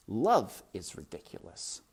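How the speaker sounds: plain reading voice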